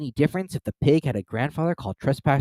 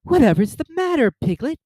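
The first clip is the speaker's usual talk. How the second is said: put-on raised, higher child-character voice; Christopher Robin; 5 dB louder than usual. A narrator's reading. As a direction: ask in surprise, pitched well up. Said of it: "said Christopher Robin".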